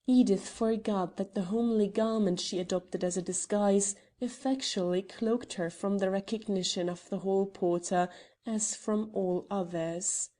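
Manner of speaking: ordinary delivery, nothing marked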